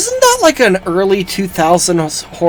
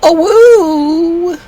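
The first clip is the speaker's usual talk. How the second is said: calling out; 13 semitones higher, much slower than usual. Pretend. Calling out in the game.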